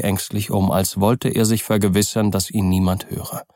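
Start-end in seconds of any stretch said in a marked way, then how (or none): none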